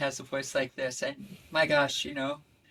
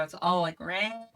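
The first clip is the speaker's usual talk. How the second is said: usual speaking voice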